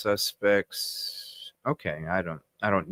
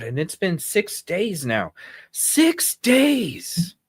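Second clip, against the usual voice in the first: angry rant; 10 semitones higher than usual. Real, not acted. Streamer venting at police over withheld footage, angry and raised. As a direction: vent at law enforcement, voice up high. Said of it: "I am so frickin' mad".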